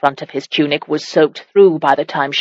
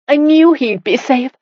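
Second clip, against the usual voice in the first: high and louder, close to a cry; 9 semitones higher, 3 dB louder than usual. A narrator's reading. Wife crying out to her husband, high and loud. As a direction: burst out with it. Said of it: "she cried out".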